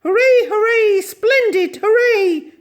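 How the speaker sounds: very high, loud shrill cheering; shrill cry. Acted. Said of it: "she cried in her shrill little voice".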